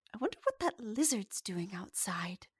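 hushed whisper, no voice; passerby whispering. Acted. Passerby whispering about the lizard to her friend. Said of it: "someone who walks by".

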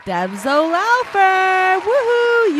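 high, slow cheering; excited. Acted. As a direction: celebrate the winner. Celebrating the raffle winner.

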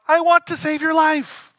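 high, loud and slow plea; Jesus pleading with his hometown, high and loud; earnest cry. Retold or imitated. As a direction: call it out, pleading. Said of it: "Jesus is kind of like".

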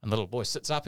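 normal talking voice

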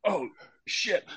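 high, soft sheepish gasp of dread. Retold or imitated. Goalie caught out by fuming manager, sheepish dread. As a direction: shrink as the boss turns on you. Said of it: "I was like".

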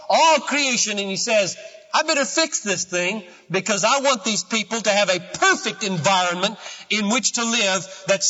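high, loud preaching climax; impassioned preaching. Acted. Preacher telling the church about creation, in impassioned preaching. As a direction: proclaim it to the whole church. Preacher builds to full force.

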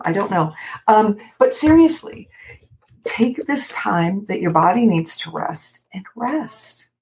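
usual speaking voice

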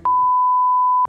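bright high laugh; short laugh. Real, not acted. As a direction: laugh at your own joke. Joke about giving her pin.

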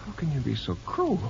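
mock plea. Flirty patient mock complaint.

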